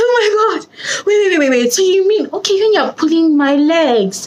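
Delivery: high, giggly amazed voice; laughing disbelief. Retold or imitated. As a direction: squeal it as if you can't believe it. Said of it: "They're like".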